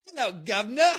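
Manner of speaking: hammed-up Cockney greeting, pitched well up; an American's overdone fake Cockney accent; bad Cockney accent. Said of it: "the worst Cockney style accent ever".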